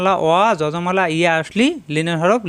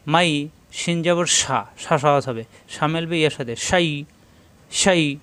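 ordinary delivery

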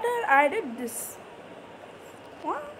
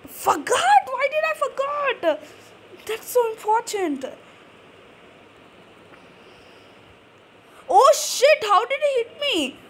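raging gamer; 9 semitones higher, 3 dB louder than usual. Real, not acted.

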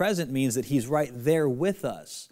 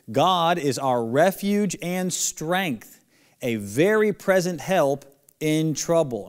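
slow scripture reading; 3 semitones higher, slower than usual. Shown as a demonstration. First reading of the verse.

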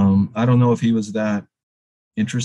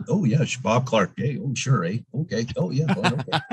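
put-on sing-song Canadian accent; hammed-up Canadian, pitch swooping on each little phrase; string out agreeable Canadian filler words; Bob Clark; 3 semitones higher than usual. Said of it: "as Canadian as it gets".

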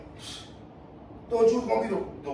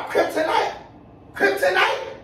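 high rising question on the name; incredulous disbelief; 6 semitones higher, slower than usual. Real, not acted. Man learns who the baby's father is, incredulous. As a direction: repeat the name as if it were absurd.

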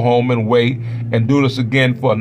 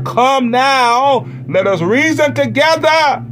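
much higher, calling out; preaching cry; 11 semitones higher, slower than usual. Real, not acted. Preacher calling the congregation to come, pitched high. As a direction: call them in at full voice. Preacher's climax over music.

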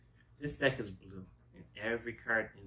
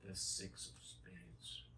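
slow, soft dramatic echo; slow hushed repeat; 4 semitones lower, 13 dB quieter, much slower than usual. Acted. Repeating the card slowly.

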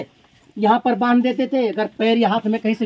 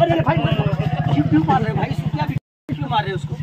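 urgent protest; 4 semitones higher than usual. Real, not acted. Protesting at man attacking the snake.

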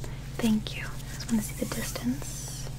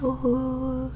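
long, whispery "ooh" on a steady note; demonstrated "ooh". Shown as a demonstration. Examiner showing a patient an O shape, drawn-out ooh. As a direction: round the mouth and hold an "ooh". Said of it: "can you make that sound".

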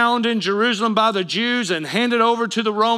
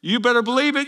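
ordinary delivery

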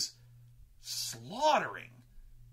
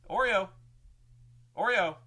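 real call to a pet, raised and loud; calling the dog; 7 semitones higher, 7 dB louder than usual. Real, not acted. Owner to puppy having a dream, calling out. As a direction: call out high to wake the dog.

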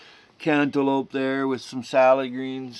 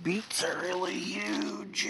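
whisper; 9 semitones higher, 8 dB quieter than usual. Real, not acted. Marvelling at the beets.

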